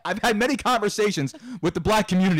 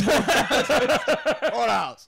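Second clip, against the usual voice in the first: laughing, higher Soup Nazi quote; 7 semitones higher, much slower than usual. Retold or imitated. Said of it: "went soup Nazi from Seinfeld".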